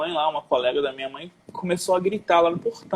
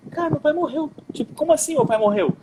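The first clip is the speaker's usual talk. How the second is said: incredulidade num tom bem mais agudo; choque retratado; 12 semitones higher than usual. Retold or imitated. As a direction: reviver a notícia sem acreditar, voz subindo. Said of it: "eu fiquei meio sem chão". (chocado, sem chão, relembrando)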